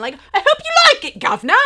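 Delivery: loud, high hammed-up Cockney shout; Cockney accent. Vlogger mocking her own slip, exaggerated Cockney accent. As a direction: belt it out as a cartoon Cockney. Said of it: "'cause that's like a Cockney accent".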